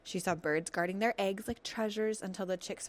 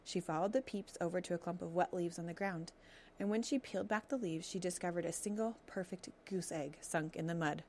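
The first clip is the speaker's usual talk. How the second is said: ordinary delivery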